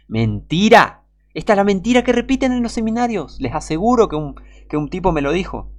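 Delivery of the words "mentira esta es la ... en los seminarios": loud, high-pitched denunciation; indignant outburst. Real, not acted. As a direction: cry out against the lie, voice leaping high. Denouncing a quoted claim as lies. (grito indignado y agudo)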